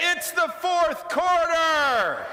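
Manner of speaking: loud and slow, each word hammered; rallying cry. Shown as a demonstration. Speaker to attitude partner, a slow loud rallying cry. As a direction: fire up the team for the final push. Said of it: "look at your attitude partner and say".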